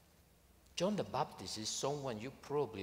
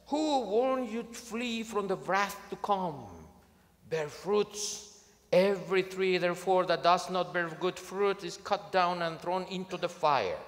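loud, raised prophet's warning; John the Baptist preaching; 6 semitones higher, 6 dB louder than usual. Retold or imitated. John the Baptist warning the crowds, loud and fierce. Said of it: "And he says".

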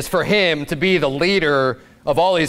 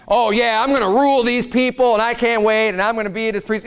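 mock eager; 8 semitones higher than usual. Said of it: "Moses was just like".

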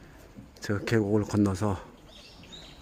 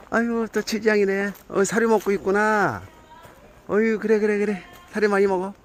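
pet talk; 8 semitones higher than usual. Real, not acted. Cooing at cheese cat.

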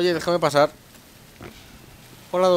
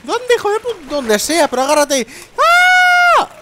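high loud panic, ending in a scream; high frantic shout; panicked character; 11 dB louder, slower than usual. Pretend. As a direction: yell in panic.

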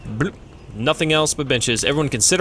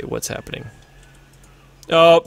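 normal talking voice

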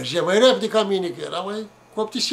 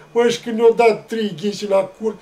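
ordinary talk, nothing marked

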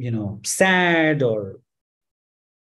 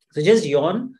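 ordinary delivery

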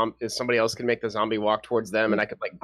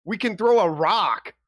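higher, louder defensive protest; argumentative; 9 semitones higher, 4 dB louder, slower than usual. Real, not acted. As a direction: push back, exasperated. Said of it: "So are y'all are actually arguing about it".

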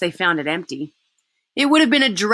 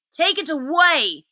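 sulky; 9 semitones higher, slower than usual. Acted. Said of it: "said Edmund sulkily".

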